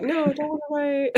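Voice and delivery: mock reassurance. Imitating reassuring chat messages.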